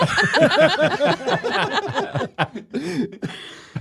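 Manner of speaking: long, high laughing fit. Real, not acted. Cracking up with co-hosts over his joke, high laugh. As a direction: keep laughing, high and helpless.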